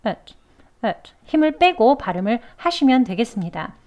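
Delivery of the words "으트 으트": 으트 with the vowel said weak and relaxed; teacher shows 으트 with the vowel eased off. Shown as a demonstration. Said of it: "약하게 해주시는 거예요".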